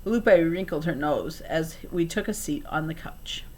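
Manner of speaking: plain reading voice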